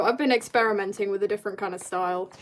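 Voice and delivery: ordinary talk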